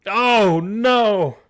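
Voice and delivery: high, loud, drawn-out wail; dismayed cry. Real, not acted. Host reacts to the last encounter's roll, loud dismayed cry.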